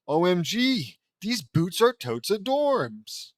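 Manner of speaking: high, loud, drawn-out squeal of delight; hammed-up valley-girl enthusiasm; excited gushing. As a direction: gush like a smitten fashion fan. Lenny gushes over pink boots.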